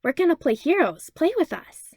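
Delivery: put-on high, eager child voice; a five-year-old pleading to play heroes; high begging kid voice; eager little kid. A narrator's reading. Small kids begging to play.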